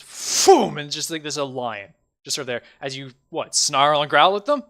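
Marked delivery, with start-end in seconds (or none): 0.0-0.8 whoosh of transformation. Acted. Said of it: "it just goes".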